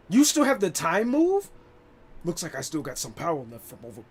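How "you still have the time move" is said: surprised shout. Acted. Character dialogue read out.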